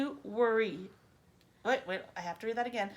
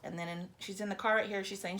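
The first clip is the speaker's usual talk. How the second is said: usual speaking voice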